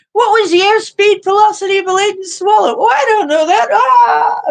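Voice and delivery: high, shouted British comedy quiz; Monty Python. Acted. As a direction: panic in a silly high British voice. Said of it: "the Monty Python code words".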